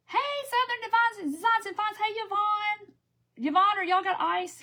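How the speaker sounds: high, bright-eyed hello called out to friends; excited greeting. Real, not acted. Host greeting chat viewers by name, high and excited. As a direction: squeal a happy hello to the regulars.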